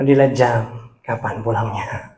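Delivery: low, soft aside of a bored listener; bored muttering. Acted. Imitating congregant checking the time, low bored murmur. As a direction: murmur it low, waiting to go home.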